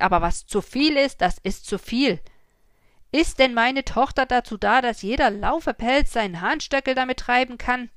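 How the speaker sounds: loud, raging peasant tirade; furious ranting. A narrator's reading. Father ranting about his daughter's attackers, loud and furious. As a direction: rage at the soldiers. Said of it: "schimpfte gewaltig".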